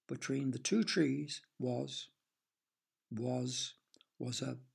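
slow, fading suspense pause; suspense build-up. A narrator's reading. Narrator teasing the listeners with suspense. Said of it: "can you guess".

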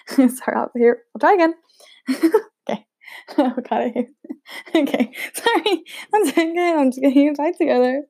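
real giggles breaking up the words; high-pitched, apologetic laughing fit. Real, not acted. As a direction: try to recover while still laughing. Cracks up after botching the take.

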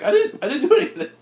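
high, loud, rushed protest; panicked denial. Acted. Luigi blamed by Mario, high hurried panicky denial. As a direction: blurt it out fast and high in defence.

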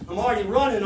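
ordinary talk

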